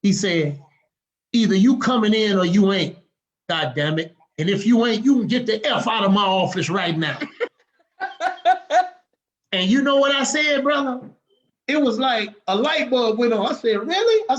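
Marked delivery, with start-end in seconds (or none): 1.3-7.2 louder, hard rebuke to a no-show recruit; angry loud scolding. Retold or imitated. Captain telling off the recruit who skipped orientation, loud angry rebuke. 13.9-14.2 high, loud astonished question; stunned surprise. Retold or imitated. Young recruit floored by the captain's blunt words, high startled question.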